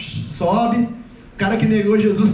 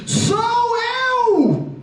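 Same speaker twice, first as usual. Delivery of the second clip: high, stretched-out cry; ringing announcement with each word held; bellowed call; 11 semitones higher than usual. Acted. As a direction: holler it as if far away. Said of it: "dá um berro mesmo".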